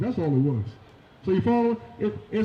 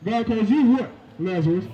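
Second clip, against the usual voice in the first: normal talking voice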